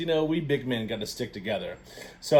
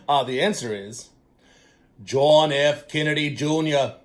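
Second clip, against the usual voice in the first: put-on a light Boston accent as Kennedy, a touch louder; JFK impression; 3 semitones higher, 8 dB louder than usual. Host revealing the answer as Kennedy, light Boston accent. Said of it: "in my best impression of this president".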